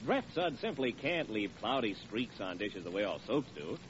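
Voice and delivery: ordinary talk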